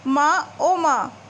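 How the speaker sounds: slow drawn-out groan calling mother. Acted. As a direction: moan for his mother in pain. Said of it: "ज़ोर से कराकर बोलता है".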